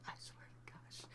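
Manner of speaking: hushed, voiceless whisper; whispered aside. Real, not acted. Storyteller swearing it's true to the viewers, whispered. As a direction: whisper it under your breath.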